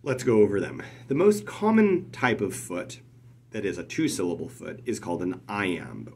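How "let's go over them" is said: ordinary delivery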